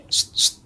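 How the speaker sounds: soft voiceless hiss to get attention; imitated beckoning "sst". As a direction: quietly hiss as if calling someone from behind. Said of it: "denger ada orang yang kayak". (tiruan desis memanggil, pelan)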